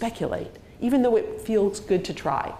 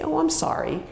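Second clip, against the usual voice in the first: higher-pitched sympathetic "oh"; empathic sorry; 9 semitones higher than usual. Shown as a demonstration. Reacting to a colleague's great-grandfather's death, sympathetic. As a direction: offer condolence without taking any blame. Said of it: "The word sorry is totally contextual".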